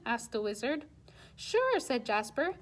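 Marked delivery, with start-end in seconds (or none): 1.3-1.8 eager king. Acted. King eagerly answers wizard.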